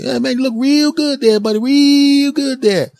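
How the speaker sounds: high, loud excited clerk; store clerk voice. Retold or imitated. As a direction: play the hyped-up shop guy calling out.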